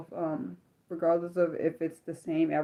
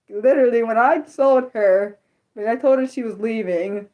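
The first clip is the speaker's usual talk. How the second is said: emphatic raised voice; 4 semitones higher, 8 dB louder than usual. Real, not acted. Emotional recall of mom.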